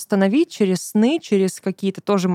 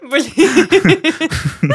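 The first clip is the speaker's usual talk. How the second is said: bursts into laughter; laughing; 10 semitones higher than usual. Real, not acted. Laughing at the joke.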